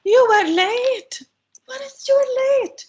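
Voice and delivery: high, loud alarm on "You were late"; worried demo. Shown as a demonstration. Aunt worries about late niece, worried outcry without blame. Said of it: "expressing my worries about this".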